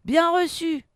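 loud, high radio-style reply; captain's acknowledgement. Acted. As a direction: call back briskly to the crew. Captain acknowledges crew report. (réponse forte de capitaine)

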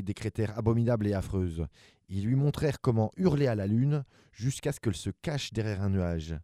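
usual speaking voice

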